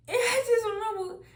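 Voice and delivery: high, loud, tearful burst; crying out. Real, not acted. Recalling the last hug with mama, crying out. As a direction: voice shoots up loud as if sobbing.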